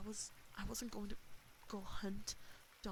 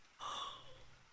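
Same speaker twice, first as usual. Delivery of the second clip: a sudden high airy gasp; sharp high gasp. Real, not acted. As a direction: gasp in real shock. Shot fired, shocked reaction.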